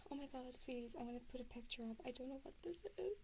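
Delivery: usual speaking voice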